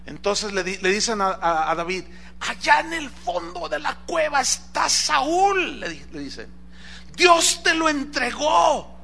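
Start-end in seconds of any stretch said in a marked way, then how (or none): 2.4-5.8 high, slow, drawn-out voice of an excited informant; urging follower. Acted. David's men alert him to Saúl in the cave, high urgent voice. 7.2-9.0 high, drawn-out acted cry; urgent exclamation. David's men urge him to seize his chance, high insistent cry.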